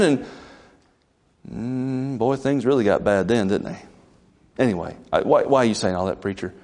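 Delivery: ordinary delivery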